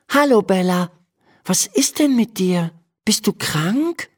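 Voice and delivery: high, light voice for a concerned Emma. Acted. Emma checks on her listless horse in the stable, high concerned voice.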